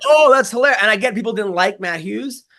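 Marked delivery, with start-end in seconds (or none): 0.1-0.8 high, loud mock glee; mocking fans. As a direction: parrot the crowd's cheap delight, mock laughing tone. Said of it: "and people were like".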